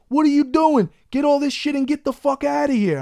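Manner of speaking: hammed-up fed-up officer barking orders; aggravated cop. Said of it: "another aggravated fucking cop".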